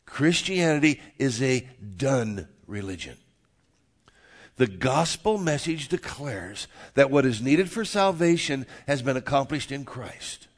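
loud, deliberate declarations with weighty pauses; emphatic preaching. Real, not acted. Pastor declaring the gospel to the congregation, emphatic preaching. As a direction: preach it firmly, landing hard on key words.

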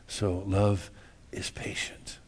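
slow, soft whisper. Real, not acted. Preacher whispering the point to congregation, slow. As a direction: breathe it out like a secret.

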